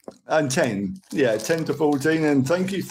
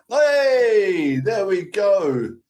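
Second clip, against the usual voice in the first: excited cheer; 6 semitones higher, 3 dB louder, much slower than usual. Real, not acted. Cheering something on the live stream.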